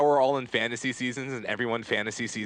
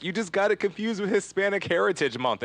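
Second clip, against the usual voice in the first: mock yell; 9 semitones higher than usual. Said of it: "is what I'm yelling through the radio".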